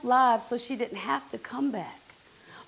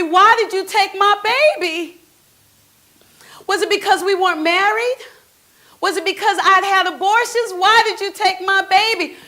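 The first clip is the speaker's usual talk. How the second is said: louder, higher angry questions at God; angry demand; 7 semitones higher, 8 dB louder than usual. Retold or imitated. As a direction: confront God, furious and hurt. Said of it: "I was angry".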